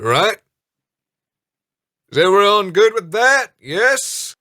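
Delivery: high, loud captain's address; rallying the crew. Acted. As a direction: rouse the crew, demand an answer.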